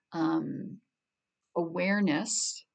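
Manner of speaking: ordinary talk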